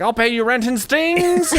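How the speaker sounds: high, loud gleeful villain insect; mock wasp. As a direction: squeal it like a spiteful wasp. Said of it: "They're like".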